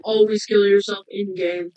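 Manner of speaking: normal talking voice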